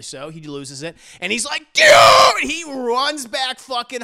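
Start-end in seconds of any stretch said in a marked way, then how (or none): 1.7-2.5 high, full-voiced furious yell; imitating an enraged shout; losing it. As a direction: explode in a hammed-up scream. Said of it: "JoJo fucking loses it".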